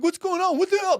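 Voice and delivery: high-pitched panicked protest; mock alarm. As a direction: imitate a startled kid being grabbed. Grabbed kid protests, acted out.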